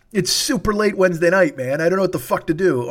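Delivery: usual speaking voice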